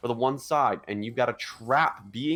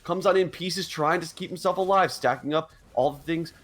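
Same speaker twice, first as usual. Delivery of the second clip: fast, high hype call of the fight; excited play-by-play; 6 semitones higher than usual. Real, not acted. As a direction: call the teamfight at full hype. Hyped play-by-play of teamfight.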